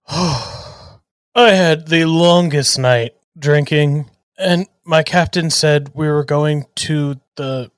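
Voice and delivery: hungover. Acted. Rubo drunk, groggy after long night.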